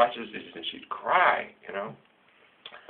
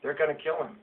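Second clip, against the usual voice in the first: daughter's worry; 6 semitones higher, 3 dB quieter than usual. Retold or imitated. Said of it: "Emmy cries every time".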